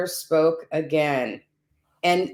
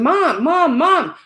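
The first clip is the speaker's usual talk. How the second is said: high, loud calling out; a mother suddenly calling out after a week of silence; calling out Mom; 9 semitones higher, 5 dB louder, much slower than usual. Retold or imitated. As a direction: call for her daughter across the house. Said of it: "my mother in real life starts calling".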